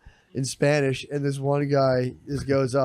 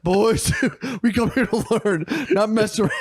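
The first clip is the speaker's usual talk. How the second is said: mock mad and serious; 6 semitones higher than usual. Friend retells how the priest scolded the boys, laughing. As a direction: play the angry teacher but crack up. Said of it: "He got all mad and serious".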